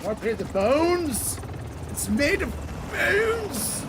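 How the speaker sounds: high, loud cry drawn out on bones; hammed-up shout. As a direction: belt it out in mock drama. Player hamming up a gun's name.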